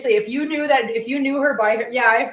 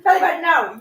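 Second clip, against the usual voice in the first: louder, higher Belly button with a firm falling no; firm no to the cat; 5 semitones higher, 6 dB louder than usual. Real, not acted.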